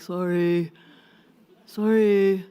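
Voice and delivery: tossed-off mumbled sorry, no meaning; mock careless sorry. Kid's hollow apology to his brother, careless. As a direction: toss off a hollow apology like a kid. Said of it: "It's just words".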